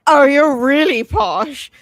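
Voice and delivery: loud, slow, drawn-out teasing; exaggerated retold amazement; teasing mock awe. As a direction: stretch it out high to tease someone posh. Imitates teasing him for being posh.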